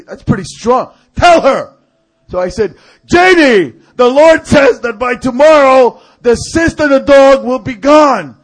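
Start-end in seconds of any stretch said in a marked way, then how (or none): 1.2-1.7 high, loud order; acted stern shout; insistent command. 3.1-8.4 high, shouted prophetic announcement; hammed-up prophet delivering a message; loud proclamation.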